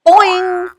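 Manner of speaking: high springy boing sound; cartoon bungee boing. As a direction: pop the boing up high and loud. Bungee rebound sound word.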